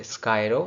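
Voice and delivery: स्कायरो run together as one linked word; linked syllables. Shown as a demonstration. Teacher linking words for students. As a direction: join the sounds into स्कायरो. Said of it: "इसको link कर लेंगे".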